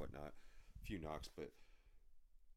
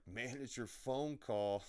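slow, higher, drawn-out singsong jab; mock retort; 7 semitones higher, 5 dB louder, slower than usual. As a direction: stretch it out high and mocking. Mocking how he'd answer yellers.

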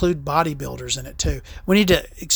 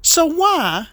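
retold confrontation; 8 semitones higher than usual. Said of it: "I was sitting there like".

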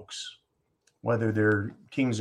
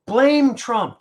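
high, loud mock slogan; mocking Democrats; 10 semitones higher, 7 dB louder than usual. Host parrots the Democrats' playbook, high mocking cry. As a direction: squawk it like a parroted party line. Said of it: "What is their playbook?".

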